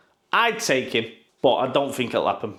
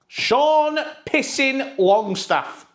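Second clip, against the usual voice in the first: mocking; 12 semitones higher, much slower than usual. Mocking rumour name.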